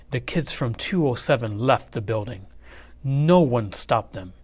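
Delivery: ordinary delivery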